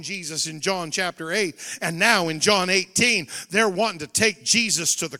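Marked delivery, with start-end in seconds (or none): none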